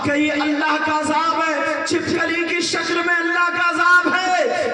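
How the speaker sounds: loud, chanted sermon; fiery sermon, sung and shouted; preaching at full force. Acted. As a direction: thunder the warning at the listeners. Full-force preaching, warning girls.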